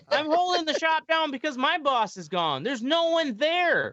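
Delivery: high-pitched exasperated rant; retold phone yelling. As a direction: re-enact scolding the parents over the phone. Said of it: "I was yelling on the phone".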